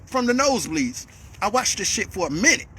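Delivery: ordinary talk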